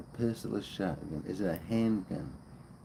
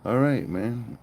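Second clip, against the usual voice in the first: ordinary delivery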